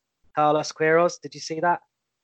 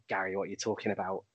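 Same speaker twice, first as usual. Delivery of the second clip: low, quick, softer scoff at a pundit; dismissive Roy Keane; 7 semitones lower, 11 dB quieter, faster than usual. Retold or imitated. Mocking Gary Neville as the scornful pundit, low and dismissive. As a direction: brush it off as a grumpy pundit. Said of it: "he's just like".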